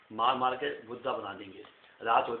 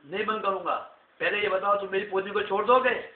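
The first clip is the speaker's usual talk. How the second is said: bargaining; 7 semitones higher, 7 dB louder than usual. Acted. Hero bargaining with the king.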